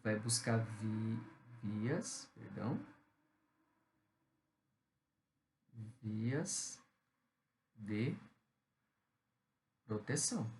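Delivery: very slow, word by word, with long pauses; teacher dictating to the class while writing. Shown as a demonstration. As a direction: spell it out slowly as you write it on the board. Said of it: "Deixa eu colocar aqui".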